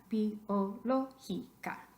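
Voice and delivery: slowly separated syllables, bi-o-ló-gi-ca. Shown as a demonstration. Teacher splitting biológica for students, syllable by syllable. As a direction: sound out "biológica" one syllable at a time.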